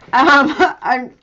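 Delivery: laughing over a funny text. Real, not acted. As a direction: giggles breaking through the words. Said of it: "I don't know why I'm laughing now".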